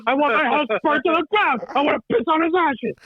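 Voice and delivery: high, hammed-up De Niro-style demands; mock gangster rant. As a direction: parody a raging mob boss at home. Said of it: "sound like me with my family in quarantine".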